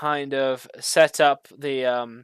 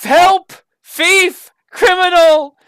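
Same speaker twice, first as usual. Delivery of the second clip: very high, very loud alarm cries for help; a cursed staff screaming to raise the alarm; magical alarm crying out; 13 semitones higher, 10 dB louder, slower than usual. Acted. The broken staff cries out at the thief, loud and high. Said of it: "the thing cries".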